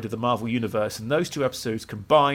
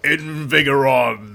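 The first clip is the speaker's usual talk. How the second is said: TV quote; 4 dB louder, slower than usual. Retold or imitated. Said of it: "all I can think of is It's Always Sunny".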